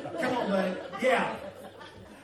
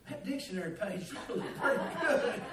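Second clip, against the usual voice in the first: laughing; 7 dB quieter than usual. Real, not acted. Wry thought, laughing.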